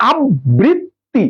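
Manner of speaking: "আবৃত্তি" slowly in pieces, ab-brit-ti; broken into syllables. Shown as a demonstration. Teacher showing how to read it, "আবৃত্তি" broken as ab-brit-ti. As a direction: pause between each syllable of "আবৃত্তি". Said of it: "ভাইঙ্গা ভাইঙ্গা আপনার পড়তে হবে".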